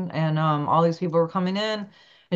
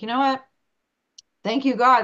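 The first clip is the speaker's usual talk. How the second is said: grateful prayer; 6 semitones higher, 3 dB louder than usual. Retold or imitated. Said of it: "I was like".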